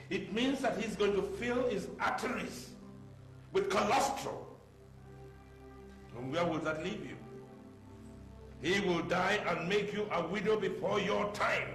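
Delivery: high, emphatic warning; scolding alarm. Acted. Father warns his daughter about her man's diet, scolding. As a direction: scold with mounting outrage.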